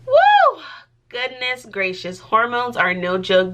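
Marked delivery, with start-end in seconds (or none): 0.1-0.6 a sudden high, loud whoop; excited woo. Real, not acted. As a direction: let out a wild, high whoop about hormones. Hormones rant, sudden outburst.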